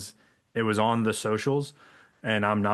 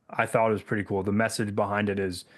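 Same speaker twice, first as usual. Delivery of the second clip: ordinary delivery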